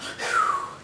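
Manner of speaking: big audible exhale; demonstrative sigh. Shown as a demonstration. Vlogger showing viewers what to do once in a while, long sigh. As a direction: let out one long breath. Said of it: "Everyone should do this once in a while".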